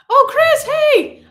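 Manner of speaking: high, loud hello; excited greeting. Real, not acted. Streamer spots Chris in the chat, excited greeting. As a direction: call out happily to someone just spotted. Greets viewer she just spotted.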